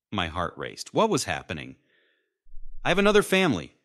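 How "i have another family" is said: loud, high burst of confession; sudden high, loud admission; blurted out. A narrator's reading. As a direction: blurt it out, pitch jumping up. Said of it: "he blurted out".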